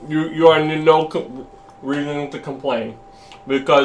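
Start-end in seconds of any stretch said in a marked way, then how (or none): 3.5-3.9 talking with a mouthful; words round a bite of food; mouth full. Real, not acted. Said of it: "don't talk with your mouth full".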